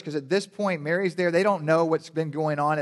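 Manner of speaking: ordinary talk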